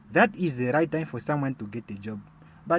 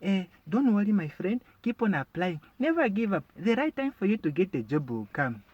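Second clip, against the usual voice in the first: higher, sing-song fake concern; mock sympathetic friend; 5 semitones higher than usual. Comedian mimics a pitying friend offering hollow advice, sing-song. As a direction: play the pitying friend, voice lifted high. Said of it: "Or they can tell you".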